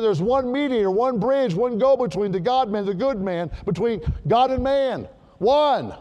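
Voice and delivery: fervent preacher crescendo; preaching at full force. Acted. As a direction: drive it home to the congregation. Preaching climax.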